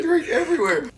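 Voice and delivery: high, breathless laugh-crying; squeaked out mid-laugh. Real, not acted. Laughing about spilled drink.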